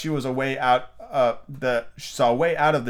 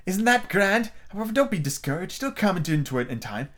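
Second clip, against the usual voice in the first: high, proud queen voice; 8 semitones higher than usual. A narrator's reading. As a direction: cheer the pupil on as a queen.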